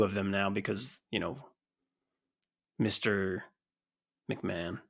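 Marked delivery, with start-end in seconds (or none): 2.8-4.8 low, hushed delivery; guarded naming. Real, not acted. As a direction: say the name softly and cautiously, dropping away. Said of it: "I'll say it like that".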